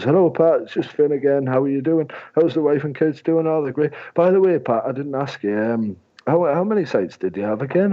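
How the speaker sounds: high, bright-toned cheery phone patter, pitched well up; breezy salesman sweet-talking a client on the phone; chirpy sales caller. Retold or imitated. Salesman phoning a client about sites, high chirpy patter. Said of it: "someone goes".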